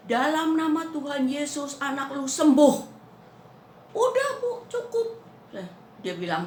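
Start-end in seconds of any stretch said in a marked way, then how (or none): 0.1-2.8 loud, high declaration of healing; commanding prayer. Retold or imitated. Maid re-enacts her healing command. 3.9-5.2 very high, loud voice of the maid; emphatic retold quote. Maid's abrupt close of her prayer.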